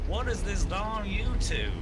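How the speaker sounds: mock Southern hillbilly voice. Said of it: "I don't know why I went into an accent there".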